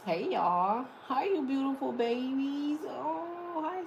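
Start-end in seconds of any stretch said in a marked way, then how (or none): none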